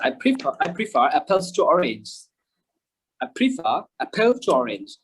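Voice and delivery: slow demonstration, light R in "prefer apples"; slight linking R. Shown as a demonstration. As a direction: link "prefer" to "apples" with barely an R. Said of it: "কিঞ্চিত উচ্চারণ করবো". (ধীরে, "prefer"-এ কিঞ্চিৎ র)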